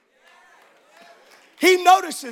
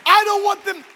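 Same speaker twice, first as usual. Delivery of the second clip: rousing sermon climax; fiery preaching; 3 semitones higher than usual. Acted. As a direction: preach it out to the whole church. Preacher at full force.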